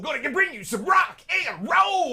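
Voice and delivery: loud, high hyped-up radio DJ; rock and roll radio voice. Pretend. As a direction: shout it like a rock radio host. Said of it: "give me a rock and roll voice".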